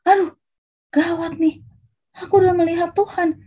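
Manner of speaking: high-pitched mock dismay; acted alarm. Gideão percebe que viu Deus.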